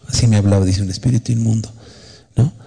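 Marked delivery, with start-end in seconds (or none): none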